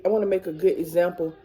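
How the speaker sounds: ordinary delivery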